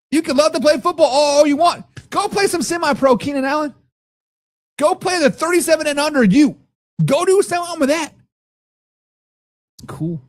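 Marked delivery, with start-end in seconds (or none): 0.1-8.1 heated rant. Real, not acted. Ranting at chatter about Allen.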